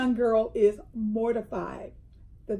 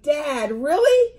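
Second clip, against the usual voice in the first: put-on high, loud teen-style protest at a dad; mock-mortified disbelief, rising sharply; exasperated daughter; 6 semitones higher, 8 dB louder, slower than usual. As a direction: imitate an embarrassed daughter, pitch leaping up.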